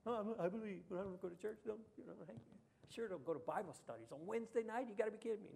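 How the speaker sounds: mock the lukewarm believer making excuses.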